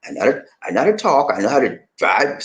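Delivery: ordinary delivery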